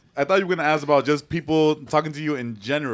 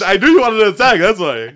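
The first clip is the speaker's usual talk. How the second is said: louder, higher teasing quote; mock accusing friend; 6 semitones higher, 10 dB louder than usual. Teasing a friend over the charity mix-up, louder and higher. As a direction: play the friend caught out, loud. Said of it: "He's like".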